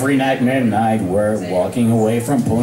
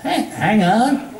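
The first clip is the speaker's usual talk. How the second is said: confused; 8 semitones higher, slower than usual. Acted. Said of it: "looking quite confused".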